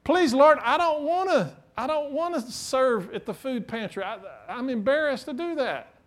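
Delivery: high, hammed-up whine of excuse; mock pleading. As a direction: plead your way out of a chore. Mocking reluctant churchgoer begging God.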